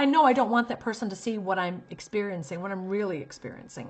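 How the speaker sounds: ordinary delivery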